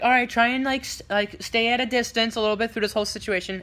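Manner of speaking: lightly voiced mom giving instructions; imitated parents. Moms arranging pictures with Spider-Man, lightly imitated. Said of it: "and they're like".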